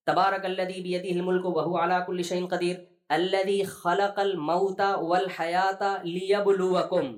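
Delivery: slow, drawn-out recitation of the verse; Quranic recitation. Acted. Preacher reciting a verse to the congregation, slow and drawn out. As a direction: intone the ayah slowly.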